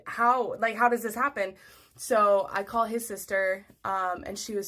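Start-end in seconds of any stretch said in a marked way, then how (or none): 0.1-1.5 loud, raised disbelief; bewildered. Retold or imitated. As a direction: demand an explanation in shock. Retold bafflement at surprise pregnancy.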